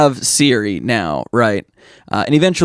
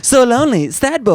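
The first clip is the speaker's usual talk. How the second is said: put-on high hologram girl voice; 12 semitones higher than usual. Joining the hologram voice bit.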